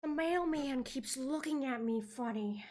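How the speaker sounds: slow, breathy-free dreamy drawl of a celebrity; Jennifer Coolidge impression. Guess-who game for friend, slow dazed celebrity impression. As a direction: float the words out slowly, dazed. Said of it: "It's Jennifer Coolidge".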